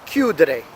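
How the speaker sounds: "chiudere" with the emphasis on CHIU; stress on first syllable. Shown as a demonstration. Teacher listing ERE verbs, stressing CHIU in "chiudere". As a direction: hit CHIU at the start of "chiudere". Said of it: "falling on the beginning".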